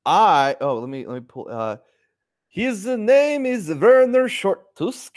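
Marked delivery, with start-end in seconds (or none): 2.5-5.1 slow, high mock-Swedish drawl for his half-orc; fake Swedish accent. Player introducing his half-orc in a fake Swedish accent. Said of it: "a fake Swedish thing going on".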